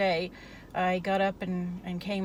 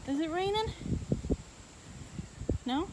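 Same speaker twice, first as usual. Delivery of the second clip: even higher sing-song pet voice; talking to a dog, higher; 8 semitones higher, 5 dB quieter than usual. Real, not acted. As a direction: coo the question up high. Asking the dog again.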